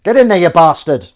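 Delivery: loud burst at a stubborn pan; exasperated shout. Real, not acted. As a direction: bark it out in frustration.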